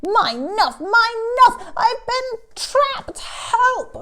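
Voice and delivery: "nuff" for nose, high loud cry; pinched-nose speech. Acted. Elephant grabbed by Crocodile, muffled high cry for help. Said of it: "he can't even speak properly".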